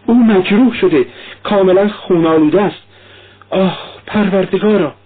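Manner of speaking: high-pitched horrified outcry; acted panic, pitched high; alarmed cry. A narrator's reading. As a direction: cry out in shock over an injury. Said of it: "فریاد زد".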